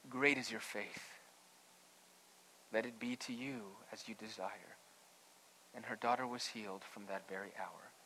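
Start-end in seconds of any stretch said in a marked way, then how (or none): 0.1-4.8 tender granting. A narrator's reading. Jesus answers the faithful woman, soft and tender. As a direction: grant it gently, fading almost to a whisper.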